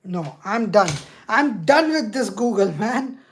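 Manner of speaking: loud, fed-up outburst; frustrated. Real, not acted. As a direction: give up in exasperation. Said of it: "It's so frustrating".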